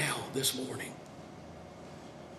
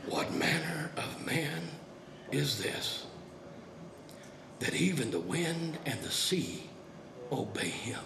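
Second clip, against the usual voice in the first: lower, hushed, fading to a whisper; stunned wonder, dropping to a whisper; awed disciples; 3 semitones lower, 7 dB quieter than usual. Retold or imitated. Disciples after the calm, hushed and awestruck. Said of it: "the disciples go".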